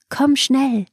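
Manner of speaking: high, urgent call to the cow. Acted. Vasishta calls the cow over. (hoher, dringender Ruf nach der Kuh)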